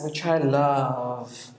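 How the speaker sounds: ordinary talk, nothing marked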